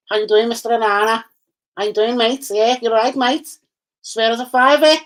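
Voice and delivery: high-pitched Scouse street greeting; put-on high Scouse voice; Toxteth accent. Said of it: "shouts out to my Toxteth brother".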